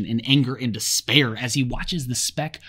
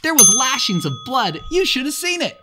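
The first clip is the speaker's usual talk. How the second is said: higher, louder eager brag; excited boast; 12 semitones higher, 6 dB louder than usual. A narrator's reading. Jack bragging to the boys, gleeful. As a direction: show off the kill with glee.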